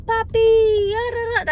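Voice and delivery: high, loud drawn-out imitation; mock drunk girl. Drunk girl calling to the driver, hammed-up cooing. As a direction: call it out like a tipsy show-off. Mimics girl's bad Spanish.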